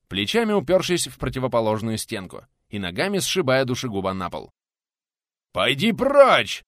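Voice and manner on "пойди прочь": loud, high, drawn-out angry roar; furious bellow; roared command. A narrator's reading. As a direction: roar him away, stretching the words. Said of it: "рявкнул Булыцкий".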